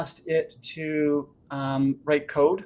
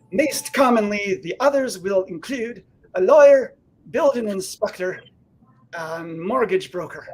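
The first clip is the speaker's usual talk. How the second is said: put-on Scottish accent; 6 semitones higher, 3 dB louder than usual. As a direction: read it in a broad Scottish accent. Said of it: "in a Scottish accent".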